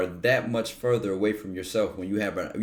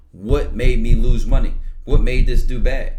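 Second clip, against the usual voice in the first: louder, slow, pressing each question; demanding self-questioning; 10 dB louder than usual. Retold or imitated. Grilling himself about his losses, loud and slow. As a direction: attack the question, slow and forceful.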